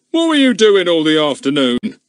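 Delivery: put-on firing a question at a frightened boy; policeman questioning. A narrator's reading. Goon grills Luke, a hint of stern policeman. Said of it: "questions that were fired".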